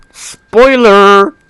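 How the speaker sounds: loud, high teasing cry; playful outburst. Real, not acted. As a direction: tease the listeners. Teasing outburst about plot.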